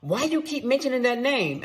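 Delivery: mock confrontational husband, high and loud; puffed-up, high-pitched challenge; Billy Bad bluster. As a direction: imitate him coming in all tough. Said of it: "like, all Billy Bad".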